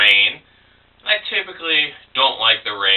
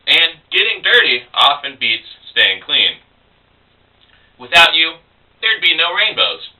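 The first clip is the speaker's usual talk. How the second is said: emphatic, higher-pitched poetry recital; poem reading; 4 semitones higher, 3 dB louder than usual. Acted. Poet addressing the rain in a poem, emphatic recital. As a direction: declaim it in measured phrases with punched words. Reads own poem aloud.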